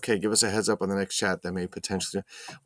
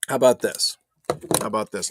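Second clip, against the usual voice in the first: ordinary talk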